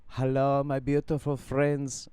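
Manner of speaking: lightly attempted imitation of his accent; Mark Bayerski impression. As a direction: put on his accent for a gentle greeting. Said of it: "I can't do his accent now".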